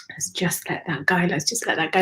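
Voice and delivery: soft unvoiced whisper letting go; whispered release. Real, not acted.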